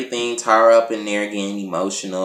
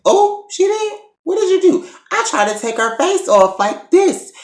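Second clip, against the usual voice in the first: squeaky high gossip voice, far above her own; high mock girl voice; 3 dB louder than usual. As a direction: play the gossiping cast member, pitched way up. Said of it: "this bitch Sam had the audacity".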